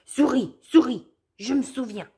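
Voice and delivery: wolf shouting. Acted. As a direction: call out sharply across the path. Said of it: "Lou crie".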